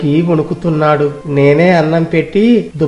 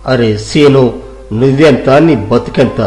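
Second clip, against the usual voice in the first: furious landlord; 2 semitones lower than usual. Acted. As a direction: tear into the cloth seller with contempt. Landlord berates the seller. (కోపంగా తిట్టే పెదకాపు)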